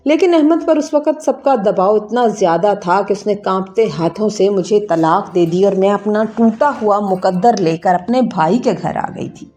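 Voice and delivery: plain reading voice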